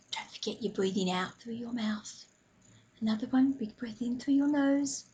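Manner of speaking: calm breathing guide, softly hushed; guided meditation. Acted. Leads a mindful breathing moment.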